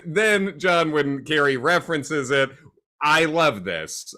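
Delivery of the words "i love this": ordinary delivery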